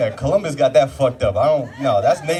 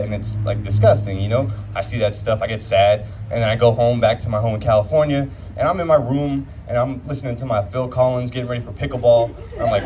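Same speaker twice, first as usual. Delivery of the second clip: ordinary talk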